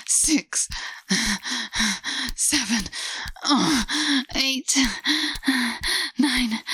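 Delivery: rising moans. Acted. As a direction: let the pleasure break through every word. Climax moaning count to ten.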